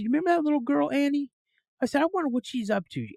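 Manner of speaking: lightly acted high-pitched son; curious recollection. Son asks mom about an old playmate, high and curious. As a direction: lift the pitch with keen curiosity.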